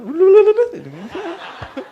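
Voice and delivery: a loud, high burst of laughter; high laughing burst. Real, not acted. Audience-style laugh after joke. (tawa lepas yang tinggi dan keras)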